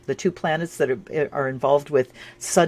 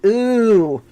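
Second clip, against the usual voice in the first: impressed ooh; 7 semitones higher, 7 dB louder than usual. Real, not acted. Reacts to drawn cards.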